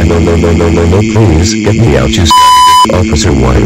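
loud, desperate pleading; loud begging. Acted. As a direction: yell no, no, no in panic. Begging while arrested.

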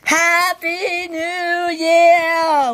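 high, slow, loud cheer; new year cheer. Real, not acted. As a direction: shout it out at midnight. Midnight cheer.

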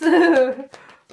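bright burst of laughter; loud laugh. Real, not acted. Reader laughing as she draws the card.

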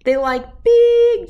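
big punched high and loud; big, booming BIG. A narrator's reading. Reading to a child, booming the word big.

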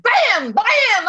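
two loud, sudden shot noises; imitated gunshots. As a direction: fire off the rifle with your voice. Imitates the rifle shots.

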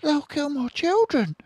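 very high, loud anguished cry; mother's anguished cry. Acted. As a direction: cry out for her cubs. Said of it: "cried Missus Fox".

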